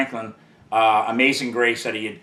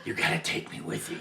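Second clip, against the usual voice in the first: hushed plea; 5 semitones higher, 12 dB quieter than usual. Retold or imitated. Begging his boss to take him.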